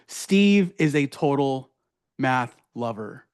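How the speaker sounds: slow, word-by-word read; reading very slowly. Real, not acted. Reading a blunder to the class, very slowly. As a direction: read it out slowly, spacing the words. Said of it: "I had to read that very slowly".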